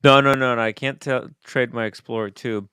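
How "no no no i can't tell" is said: ordinary delivery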